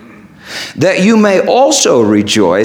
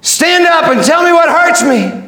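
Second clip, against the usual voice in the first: high, fervent command; calling out; 13 semitones higher than usual. Acted. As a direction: summon him at preaching pitch. Jesus calls Vince to stand.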